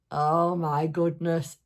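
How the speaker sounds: normal talking voice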